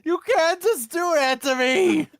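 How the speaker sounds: high, loud wailing protest; flustered protest. Real, not acted. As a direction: cry out helplessly at the teasing. Protests being broken by accent.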